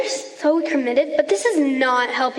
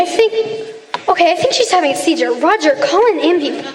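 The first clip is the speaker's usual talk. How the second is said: alarmed; 2 semitones higher, 6 dB louder than usual. Real, not acted. Panic at apparent seizure.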